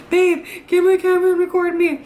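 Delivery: mock urgent call to the boyfriend; eager request. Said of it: "I'm always like".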